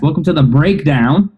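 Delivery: upbeat rewelcome; hyped welcome. Real, not acted. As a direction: sell the show name with energy.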